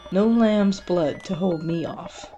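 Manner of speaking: normal talking voice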